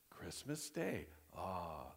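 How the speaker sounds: hushed, soft "Christmas Day", then a low sighed "ah"; softly marvelling, ending on a contented low ah; good-news awe. Acted. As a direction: savour the happy news softly. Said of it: "either for good or bad".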